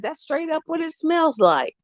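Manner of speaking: high, soft mock complaint; husband's complaint. Husband complaining about the smell, high and soft. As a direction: pipe it up in a light high voice. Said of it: "'Cause he said".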